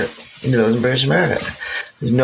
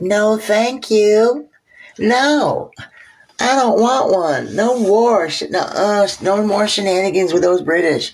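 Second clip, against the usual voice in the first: high, slow mock refusal; hammed-up squeaky no to war; Van Buren refusing; 8 semitones higher, slower than usual. President Van Buren declining war in a high whiny voice. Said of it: "He's like".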